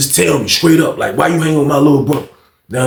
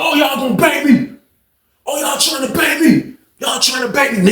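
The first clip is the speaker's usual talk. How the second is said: high-pitched, indignant cry; retold outraged protest; 10 semitones higher than usual. Inmate protesting being jumped, high outraged cry. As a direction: act out protesting a jumping, pitched way up.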